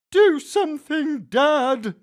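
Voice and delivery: high, loud desperate plea; pressing, urgent cry; urging. A narrator's reading. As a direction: son begging dad to act. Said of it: "my son urged".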